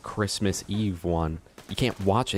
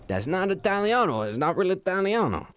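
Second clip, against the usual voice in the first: put-on higher, indignant Italian-accented rant; mock Italian; 8 semitones higher than usual. Streamer mocking Italian pasta gatekeepers, higher Italian accent. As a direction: play an outraged Italian pasta purist. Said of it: "It's like".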